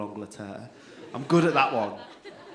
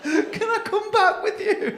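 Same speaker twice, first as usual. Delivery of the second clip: very high, slow, pleading through laughter; laughing plea; 10 dB louder, slower than usual. Real, not acted. Comic begging the French couple to take him home, laughing. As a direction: beg them to take you home, cracking up.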